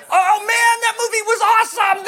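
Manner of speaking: high-pitched, hammed-up enthusiasm; gushing. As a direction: rave like an over-excited moviegoer. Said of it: "we're so careless with words".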